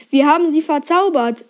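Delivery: usual speaking voice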